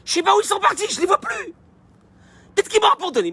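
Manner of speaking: put-on high, panicky baby voice; hammed-up baby in distress, very high; abandoned baby. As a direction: fret like an infant left alone. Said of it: "un bébé, un nourrisson, dans son lit, qui te dit".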